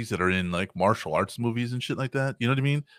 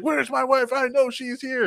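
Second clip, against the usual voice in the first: insistent angry husband; 12 semitones higher than usual. Retold or imitated.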